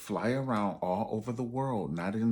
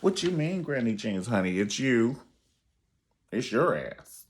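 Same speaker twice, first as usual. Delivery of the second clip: mock holler; 4 semitones higher, 5 dB louder than usual. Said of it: "a head dog will holler".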